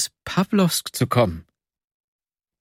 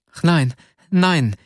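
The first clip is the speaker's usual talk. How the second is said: slow, louder pleading cry; 5 semitones higher, 3 dB louder than usual. Acted. Nastjenka begging him not to despair, crying out. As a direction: cry out against it in tears. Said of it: "rief Nastjenka aus".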